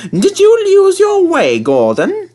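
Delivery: very high, loud mock concern; teasing. A narrator's reading. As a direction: rub it in sweetly. James teasing Gordon.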